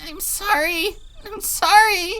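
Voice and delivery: high tearful sobbing; crying in pain. Acted. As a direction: sob out the apology. Rena crying.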